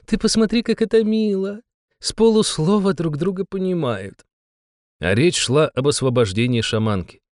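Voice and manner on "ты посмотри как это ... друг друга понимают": much higher, sing-song gushing; gushing girlish voice. Acted. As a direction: coo over how cute they are. Dolce gushing over couple.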